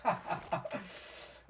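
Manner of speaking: unvoiced high laugh; real breathy chuckle. Real, not acted. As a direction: let out an airy laugh. Laughing after line.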